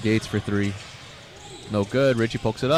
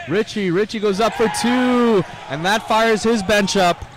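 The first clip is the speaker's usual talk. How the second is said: big excited play-by-play call, much higher and louder; excited shout; 10 semitones higher, 7 dB louder than usual. Real, not acted. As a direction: shout it like the crowd just erupted.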